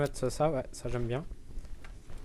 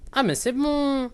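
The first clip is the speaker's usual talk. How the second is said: excited relief; 8 semitones higher, 3 dB louder than usual. Real, not acted. Relief at solving puzzle.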